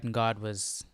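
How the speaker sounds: ordinary delivery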